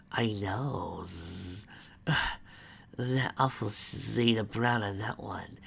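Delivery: disgusted whisper; sneering hushed aside. Acted. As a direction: hiss it as a secret complaint.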